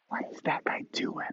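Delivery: hushed onlooker's whisper; whispered aside. Acted. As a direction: whisper it as if gossiping about a stranger. Onlooker's question repeated as a whisper.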